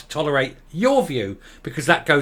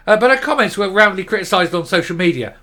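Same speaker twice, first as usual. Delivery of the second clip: ordinary talk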